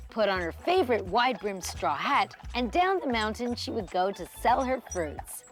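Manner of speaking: plain reading voice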